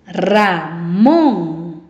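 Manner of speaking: "Ramón" held low, then MÓN punched and stretched; stress on last syllable. Shown as a demonstration. Teacher checks students hear the force, "Ramón" with MÓN stressed. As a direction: lean hard on the MÓN of "Ramón". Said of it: "¿Escuchas la fuerza de voz?".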